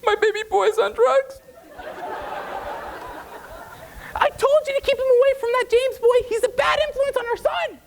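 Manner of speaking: very high shrieking mother voice; hysterical mom. Retold or imitated. Frantic mother on the phone to her drunk son, very high.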